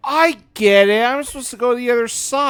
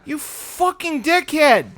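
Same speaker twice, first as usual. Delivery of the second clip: higher pitched furious cursing; angry insult; 6 semitones higher than usual. Real, not acted. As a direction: spit out the insult. Player raging at game enemy.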